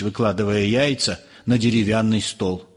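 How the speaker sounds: usual speaking voice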